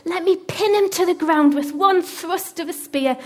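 loud, high, eager urging; worked up and pressing hard; excited. Acted. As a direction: act out an excited soldier begging to strike. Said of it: "He was so excited".

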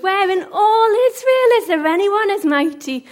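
high, loud, jeering challenge; called-out mocking accusation; taunting. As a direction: shame the sleeping guard from afar. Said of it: "David taunted".

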